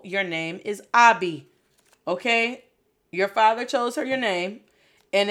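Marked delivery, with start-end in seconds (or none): 0.0-1.5 Abby said AH-bee, with an open ah; correcting pronunciation. Shown as a demonstration. Parent correcting the daughter's name, Abby as AH-bee. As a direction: firmly give Abby its ah vowel. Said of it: "I said, No".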